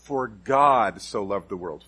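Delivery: God so loved the world with God punched; stress on God. Shown as a demonstration. Preacher stressing God to the congregation. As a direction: lean on God. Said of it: "with just emphasizing a different word".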